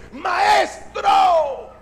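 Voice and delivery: urgent drawn-out call to the teacher. Acted. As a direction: call out to wake him. Acted disciple waking the teacher.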